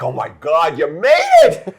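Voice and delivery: overjoyed greeting. Retold or imitated. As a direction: squeal with delight at the door. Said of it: "I'm like".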